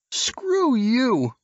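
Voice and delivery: high surfer voice snapping back; stoned surfer with a sudden edge; edgy retort. Acted. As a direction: hit back at Silas with an edge. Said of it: "The surfer's voice took on an edge".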